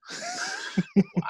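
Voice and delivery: a sudden high shrieking laugh. Real, not acted. Host laughing at the awful sequel with a shriek. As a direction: burst into a shrill laugh.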